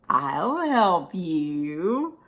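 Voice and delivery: very slow, low menace; drawn-out sinister offer; creepy voice. Acted.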